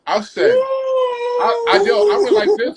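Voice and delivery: excited outburst. Retold or imitated. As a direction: burst out in excitement. Excited reaction to hearing Happy.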